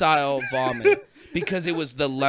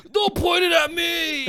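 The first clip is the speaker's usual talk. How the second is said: very high, drawn-out cry; high, stretched-out plea; mock panicked squeal. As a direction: imitate a friend squealing in alarm. Imitating friend dodging vomit.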